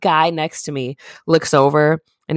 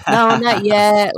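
slow, higher, lightly voiced sheepish sister; sister's sheepish answer; 4 semitones higher, slower than usual. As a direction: drag it out like a caught-out sister. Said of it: "And she's like".

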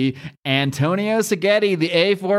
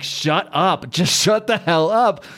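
slow, raised shout of Spawn; annoyed shout; 4 semitones higher, slower than usual. Retold or imitated. Spawn telling the joking Dragon to shut up, a raised annoyed shout. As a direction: snap at him to shut up, slowly. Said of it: "Spawn's just like".

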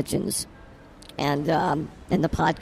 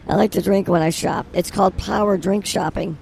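ordinary talk, nothing marked

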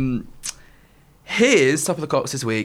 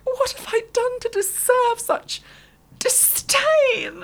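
high, slow pantomime despair; mock tearful wail; much slower than usual. Retelling a crying TV bride, mock tearful melodrama. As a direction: wail it like an overacting panto heroine. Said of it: "she was crying to one of the other girls".

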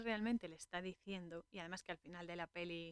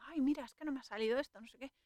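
higher, louder, fast mock complaining; mock whine; 6 semitones higher, 6 dB louder, faster than usual. Imitating someone moaning that things went wrong, mock whine. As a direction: toss off a petty gripe, hammed-up.

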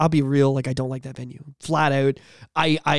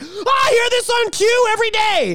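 excited crowd. Retold or imitated. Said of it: "They're like".

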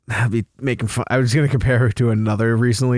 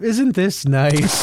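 slow smug voice; 5 semitones higher, much slower than usual. Retold or imitated. Voicing Baldwin at New Year.

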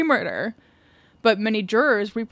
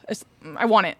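usual speaking voice

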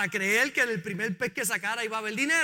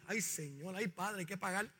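small, hushed, frightened plea; scared; 4 semitones lower, 12 dB quieter than usual. Acted. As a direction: whimper it like a scared disciple. (Pedro asustado, con voz baja y temerosa)

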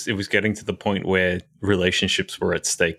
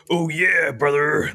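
pro wrestling voice; 6 semitones higher than usual. Retold or imitated. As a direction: hype it like a wrestling promo. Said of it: "the pro wrestling voice that everyone does".

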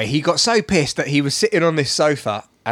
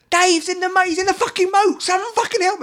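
high-pitched frantic plea; retold screaming; 13 semitones higher than usual. Begging bystanders to save friend in moat, frantic. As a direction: act out panicked cry for help. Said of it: "I'm, like, screaming".

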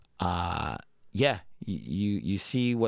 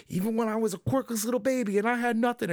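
high, put-on earnest hero voice; Deku flashback voice; 10 semitones higher than usual. Host voicing young Deku in a flashback, earnest. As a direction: sound humble and determined. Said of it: "him being like".

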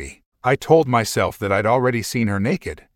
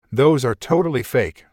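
ordinary delivery, nothing marked

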